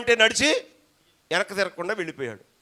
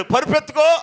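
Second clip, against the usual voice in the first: high, loud "rise"; preacher booming Jesus' command; commanding call; 10 semitones higher, 9 dB louder than usual. Retold or imitated. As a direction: call out the order to get up. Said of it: "అన్నాడు".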